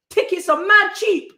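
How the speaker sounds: ordinary talk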